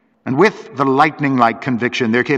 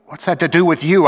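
higher-pitched indignant outburst; bursting out; 6 semitones higher than usual. A narrator's reading. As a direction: burst out with a sharp challenge. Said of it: "I burst out".

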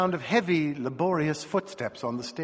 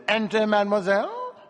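high, louder welcome to a visitor; raised-voice invitation through the door; called aloud; 8 semitones higher, 4 dB louder than usual. A narrator's reading. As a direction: call it out grandly, pitched up. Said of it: "But aloud he said".

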